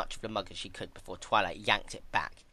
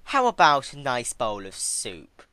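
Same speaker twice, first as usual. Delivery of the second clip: slow, higher, mock-kind offer; sugary tease; 4 semitones higher, 8 dB louder, slower than usual. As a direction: dangle the soup with fake sweetness. Twilight teasing with soup.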